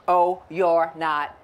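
cocky ladybug brushing off the elephant, slow and loud; scornful ladybug. Acted. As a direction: drag it out with contempt. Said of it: "you know what the ladybug responded".

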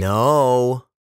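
long, loud, drawn-out refusal; emphatic high, elongated no; slow and indisputable. A narrator's reading. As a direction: stretch it out, allowing no argument. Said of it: "slowly and indisputably".

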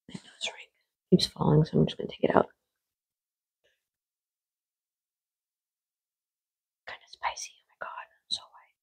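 soft unvoiced whisper. Real, not acted. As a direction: whisper it close to the mic. Whispering while eating on camera.

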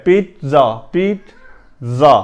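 پیتزا slowly split, "peet... za"; model pronunciation. Shown as a demonstration. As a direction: separate the t and z of پیتزا. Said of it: "بلکہ پیتزا ہے".

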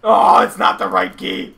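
slow, loud groaning disappointment; dismayed. Real, not acted. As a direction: wail over the wasted effort. Failed escape dismay.

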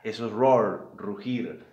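ordinary talk

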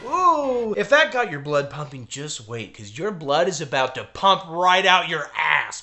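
ordinary talk, nothing marked

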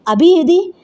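high, rising alarmed question; mother suddenly noticing a missing child; startled mother. Acted. Mother asks where daughter is. (ఉలిక్కిపడిన అమ్మ ప్రశ్న)

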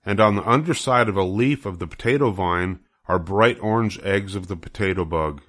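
ordinary delivery, nothing marked

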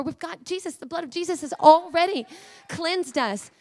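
ordinary delivery

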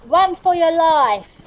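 slow, high dramatic call; being chased. Pretend. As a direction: call out like fleeing in a film. Chased by wolves in game.

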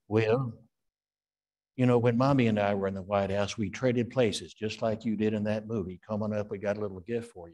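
lightly voiced Ronald Reagan; Reagan impression. Emcee calling Eddie back to the stage, light Reagan voice. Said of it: "call me back up as Reagan".

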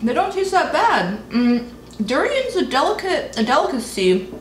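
speaking between bites; talking while chewing. Real, not acted. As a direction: mouth partly full of wafer. Seen 2: Woman bites wafer at -5, then talks holding it near her mouth.

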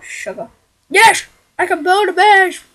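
normal talking voice

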